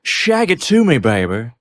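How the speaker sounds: playful hammed-up come-on; lightly put-on swinging spy; spy catchphrase impression. Austin Powers style catchphrase in a bit.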